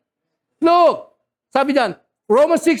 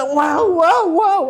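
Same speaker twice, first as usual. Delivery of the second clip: awed wow; 6 semitones higher, slower than usual. Retold or imitated. Imitating believer's awe.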